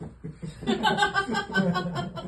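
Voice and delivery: quiet high chuckle; soft laugh. Real, not acted. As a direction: giggle at your own punchline. Laughs after covid joke.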